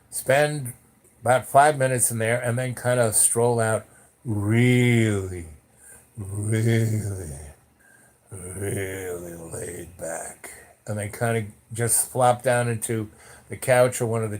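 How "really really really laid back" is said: drawn-out laid back. Acted. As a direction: stretch the really out lazily. Describing stoned people leaving.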